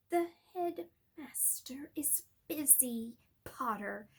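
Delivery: soft, slow, silky menace; cold Snape. Acted. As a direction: purr it with a cruel smile.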